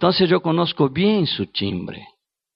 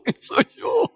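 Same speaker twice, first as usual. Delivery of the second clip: laughing question. Shown as a demonstration. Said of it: "Que yo tuve una crisis de identidad".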